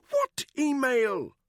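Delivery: high, loud, nervous stammer; stammering Buckingham. A narrator's reading. Buckingham cornered by Danny, stammering high. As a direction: trip over the words in a high upper-class voice.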